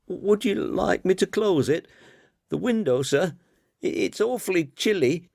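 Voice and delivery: put-on high, nervous, fumbling boy's voice; high-pitched bumbling schoolboy, eager to please. A narrator's reading. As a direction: stammer it out like a flustered young lad trying to lighten the mood. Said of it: "bumbled the boy".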